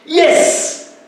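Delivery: a sudden triumphant yell; exultant shout. Acted. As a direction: cheer it out like a won battle. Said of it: "That is why it's an exultant cry, a shout".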